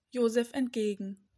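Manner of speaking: usual speaking voice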